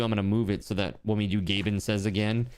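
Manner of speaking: ordinary talk, nothing marked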